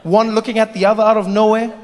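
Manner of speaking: ordinary talk, nothing marked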